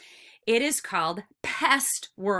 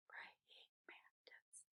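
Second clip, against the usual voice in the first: barely audible slow whisper; very soft, unvoiced sounding-out; whispered syllable count; 34 dB quieter, much slower than usual. Shown as a demonstration. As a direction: whisper Praying mantis slowly, beat by beat. Quiet recount of the syllables.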